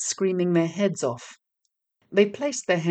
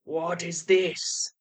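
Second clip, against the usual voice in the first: master devil; much slower than usual. A narrator's reading. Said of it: "said the devil".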